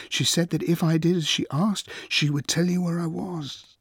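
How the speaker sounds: high, urgent, tumbling explanation; plead, words falling over each other; stumbling plea. A narrator's reading. Achilles begs his friend to listen, stumbling frantically. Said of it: "he was stumbling over his words".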